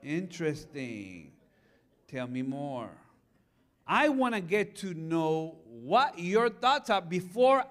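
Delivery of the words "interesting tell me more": soft, slow, low curiosity; curious invitation to son. Retold or imitated. Father answering his son's wild idea, soft and slow. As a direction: calmly draw the son out. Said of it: "I said".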